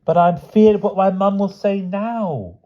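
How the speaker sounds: put-on high, drawn-out fearful child's wail. A narrator's reading. Jammy dreading what mum will say, wailing. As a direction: stretch it into one long, high wail. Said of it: "wailed Jammy".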